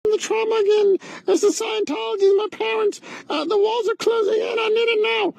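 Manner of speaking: high, whiny mock panic; mock trauma spiral. Comedian parodying a melodramatic grifter, whiny high panic. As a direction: ham up a melodramatic breakdown.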